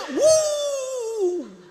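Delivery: long high mock wail; hammed-up "wuuh" of crying. Preacher imitating a parent seeing the dorm mattress, mock wail. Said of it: "siap-siap mau nangis".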